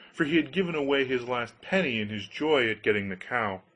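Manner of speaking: usual speaking voice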